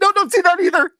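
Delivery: very high, loud, blubbering squeal; hammed-up sobbing burble; mock crying talk. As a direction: imitate trying to talk through spitty tears. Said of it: "you make that disgusting five-year-old spit bubble".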